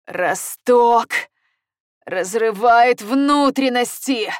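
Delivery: slow, loud pained groan. A narrator's reading. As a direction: force it out through pain. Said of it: "простонал он, тяжело дыша".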